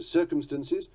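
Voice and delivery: "circumstances" as CIR-cum-stunces; reduced vowel. Shown as a demonstration. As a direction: stress the front of "circumstances", weak "stunces". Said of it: "The pronunciations vary according to".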